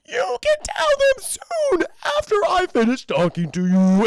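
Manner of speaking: the fake Beth keeps them coming; creepy luring voice. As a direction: tease them like a haunting spirit.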